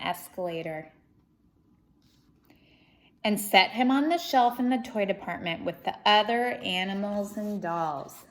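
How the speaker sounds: lively, expressive storybook reading; reading to children. Acted. Reading Corduroy aloud to children, lively storybook voice. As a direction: read it out to a young audience with energy. Reads picture book aloud.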